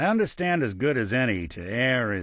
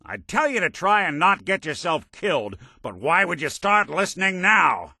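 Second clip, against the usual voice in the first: angry, high-pitched scolding; exasperated reproach; 8 semitones higher, 3 dB louder than usual. Acted. Partner telling you not to get killed, exasperated. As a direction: scold a partner who never listens.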